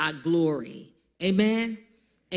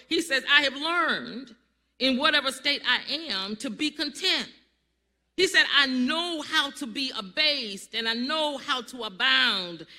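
higher, raised preaching voice; 6 semitones higher, 3 dB louder than usual. Acted. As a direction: preach the passage with fervour. Preaching Paul's words.

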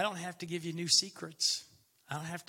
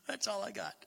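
laughing; 5 semitones higher than usual. Real, not acted. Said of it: "laughs on repeat".

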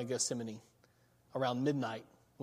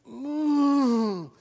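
high strained groaning sound; a pained groan of pleading; 11 semitones higher, 9 dB louder than usual. Shown as a demonstration. Preacher voicing Jesus' groaning prayer. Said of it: "It's not so much words as it is".

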